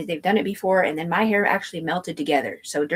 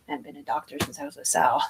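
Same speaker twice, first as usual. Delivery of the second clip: normal talking voice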